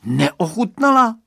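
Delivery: high, loud, drawn-out echo; amused disbelief. A narrator's reading. Boy laughing off the girl's denial, amused disbelieving echo. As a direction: throw the word back, slow and high. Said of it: "Zasmál se Radovan".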